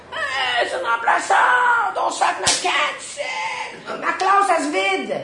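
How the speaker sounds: very high, loud mocking shout; rowdy students yelling. Teens jeering at the substitute teacher, shouting high. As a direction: holler like unruly teens.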